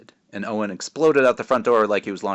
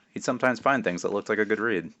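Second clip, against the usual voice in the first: usual speaking voice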